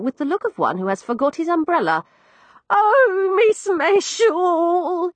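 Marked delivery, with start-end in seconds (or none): 2.7-5.1 high, loud, drawn-out quavering cry; cling to her and wail with relief; tearful old woman. Acted. Old landlady crying to the girl who found her.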